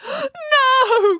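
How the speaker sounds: high, loud melodramatic wail; horrified cry. A narrator's reading. Woman sees her cat up the pole, crying out. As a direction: cry out in hammed-up horror. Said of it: "cried the woman".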